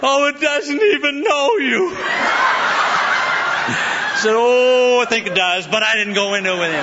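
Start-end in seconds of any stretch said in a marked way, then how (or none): 0.0-1.9 high, slow, drawn-out mock lament; astonished driver. Mimics the tearful driver's astonished remark. 4.3-5.7 long, loud, high "oh", then falling; knowing comeback. Retold or imitated. Preacher's comic knowing comeback.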